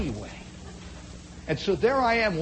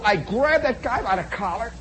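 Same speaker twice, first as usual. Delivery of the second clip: normal talking voice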